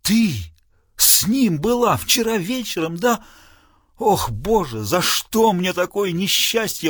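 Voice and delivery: high, slow anguished wail; wailing father. Acted. Father to his daughter, a high anguished wail. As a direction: wail it like a father whose world ends. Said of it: "взвыл лорд Саксемунд".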